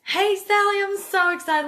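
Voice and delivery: high, loud, bubbly hello; excited greeting. Shown as a demonstration. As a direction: greet her like your best friend, thrilled. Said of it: "Just ask them".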